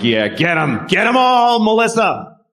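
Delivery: cheering a storm on, high and gleeful; urging the hurricane on, high and giddy. Pretend. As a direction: egg the hurricane on with glee. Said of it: "I'm like".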